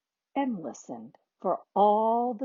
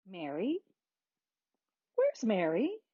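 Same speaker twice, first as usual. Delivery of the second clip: soft, questioning call; searching call; 5 dB quieter than usual. A narrator's reading. Parents missing Mary, softly calling. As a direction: wonder softly where she is.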